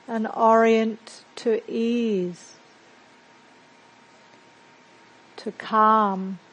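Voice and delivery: measured meditation guide with long, settling pauses; guided meditation. Acted. Teacher guiding a group to settle into silence and breathe, guided meditation. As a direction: lead them into calm, leaving space between phrases.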